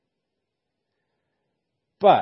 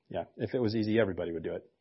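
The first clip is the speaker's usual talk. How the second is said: ordinary delivery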